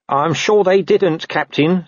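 slow, high-pitched soothing; squeaky, appeasing underling; placating corporal. Acted. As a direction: humour the boss carefully. Nobby soothes paranoid captain.

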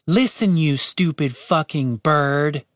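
frustrated, measured insult; slow right down and lean on each word; deliberate, exasperated emphasis. A narrator's reading. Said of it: "more slowly and deliberately than normal".

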